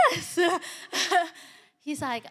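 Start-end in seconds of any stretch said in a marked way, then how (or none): 0.1-1.3 high, loud yes breaking into laughter; amused yes. Retold or imitated. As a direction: answer the repeat tap, cracking up. Laughing as boy taps again.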